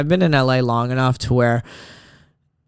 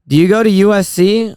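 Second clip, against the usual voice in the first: mock older gentleman, higher and louder; imitating the older man; 8 semitones higher, 8 dB louder than usual. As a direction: pipe up loudly like the curious old guy on the plane. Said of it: "He goes".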